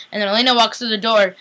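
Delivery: ordinary talk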